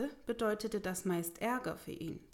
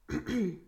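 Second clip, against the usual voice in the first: throat clearing; 5 dB louder than usual. Acted. Awkward pause before asking for help.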